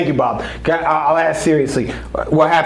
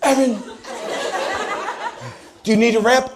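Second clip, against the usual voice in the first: lightly voiced Game of Thrones type, pitched high; a hint of fantasy-epic grandeur, raised pitch; mock fantasy-drama character; 10 semitones higher than usual. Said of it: "like a Game of Thrones character".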